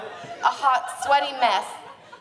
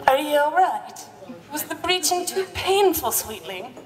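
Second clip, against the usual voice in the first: mock Scottish concern; 2 semitones higher than usual. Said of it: "he asked".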